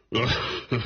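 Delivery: hearty mocking laugh of a fat farmer. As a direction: laugh off the old tale. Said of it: "Der dicke Mann lachte". (herzhaftes, spöttisches Lachen)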